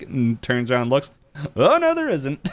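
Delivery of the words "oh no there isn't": high-pitched retold denial; comic mock alarm. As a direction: pitch it way up for a cartoonish quote. Retold cartoon character, comic denial.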